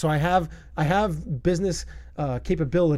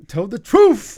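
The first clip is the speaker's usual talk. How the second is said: put-on South African accent; 5 semitones higher, 7 dB louder, much slower than usual. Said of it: "that South African accent".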